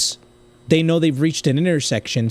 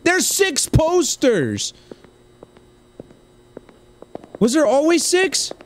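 high, incredulous cry; stunned, stretched-out exclamation; shocked discovery; 12 semitones higher, slower than usual. Real, not acted. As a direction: blurt it out in alarm, pitch way up.

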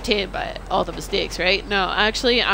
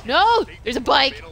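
panicked shout; 4 semitones higher, 6 dB louder than usual. Real, not acted. Panics in game.

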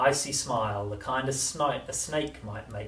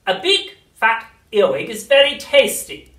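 witch voice; 12 semitones higher, 16 dB louder than usual. A narrator's reading. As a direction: cackle it like an old witch.

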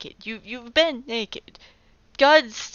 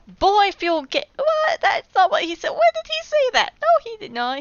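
high, loud, cracking shriek of disbelief; outraged disbelief; 12 semitones higher, 5 dB louder than usual. Real, not acted. Reader shocked by the serpent, shrieking high and loud. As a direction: yelp in shock at the misquote.

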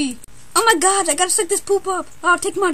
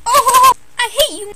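louder, higher mock-angry cry; loud outburst; 5 semitones higher, 9 dB louder than usual. Character yelling at a rival, loud mock anger. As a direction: blurt it out in a huff.

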